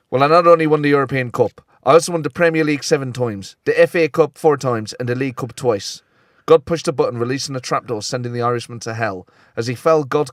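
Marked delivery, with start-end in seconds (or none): 0.1-6.0 hammed-up, amateurish Irish accent; bad Irish accent. Joke's Irishman boasting to God of his trophies, poor Irish accent. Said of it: "I couldn't do an Irish accent".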